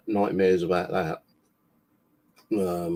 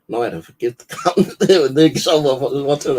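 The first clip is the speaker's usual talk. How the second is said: talking through real laughter, louder and higher; laughing; 6 semitones higher, 8 dB louder than usual. Real, not acted. Telling the listener how he forgave the bully, laughing louder and higher. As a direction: laugh your way through the line. Laughing while recounting reply.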